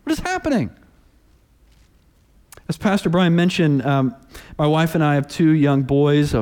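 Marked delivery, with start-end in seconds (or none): 0.0-0.7 Jonah protesting, voice pitched way up; exasperated Jonah. Acted. Preacher voicing Jonah complaining to God, high and exasperated. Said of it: "Jonah looks at the situation and says".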